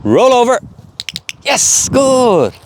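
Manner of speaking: high, slow drawn praise; command and praise. Real, not acted.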